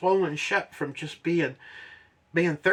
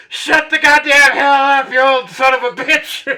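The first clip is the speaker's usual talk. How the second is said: furious retold shout; 11 semitones higher, 12 dB louder than usual. Crying climber yelling at the old man, furious. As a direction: explode at the heckler, hammed up.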